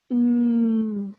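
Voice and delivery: loud closed-lip Mm demo; m sound. Shown as a demonstration. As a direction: press the lips and hum Mm. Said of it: "putting our lips together like this".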